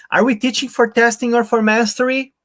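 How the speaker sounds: "we" बहुत ऊँचा, बाकी सम स्तर पर; stress on WE, very high. Shown as a demonstration. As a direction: fling the word we up high, both options level. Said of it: "if we read it this way".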